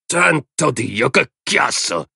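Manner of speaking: high, drawn-out exasperation; exasperated. A narrator's reading. Man complains about the dogs' noise, exasperated. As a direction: groan it out high and fed up. Man annoyed by the howling dogs.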